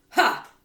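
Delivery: loud contemptuous ha; scornful snort. Acted. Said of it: "snorted Miss Trunchbull".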